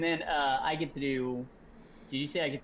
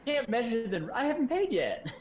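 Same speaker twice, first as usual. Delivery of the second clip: squeaky haggling customer; 12 semitones higher, 5 dB louder than usual. Acted. Mock haggling customer reply.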